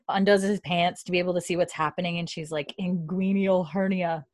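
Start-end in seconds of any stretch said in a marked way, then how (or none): 2.8-4.3 slow, matter-of-fact doctor's verdict; lightly voiced doctor stating the finding; clinical diagnosis. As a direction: pronounce it like a calm surgeon. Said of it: "Claire's in full doctor mode".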